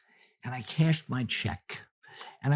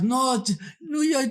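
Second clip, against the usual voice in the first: frantic insistence; 8 semitones higher than usual. Retold or imitated. Frantic woman voiced in story.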